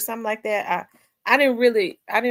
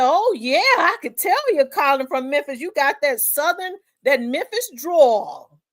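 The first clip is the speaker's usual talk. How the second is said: higher, louder mock Cajun country guy; old country Cajun; 7 semitones higher, 4 dB louder than usual. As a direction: imitate a backwoods Cajun man, voice pitched up. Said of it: "He sounded like an old country Cajun guy".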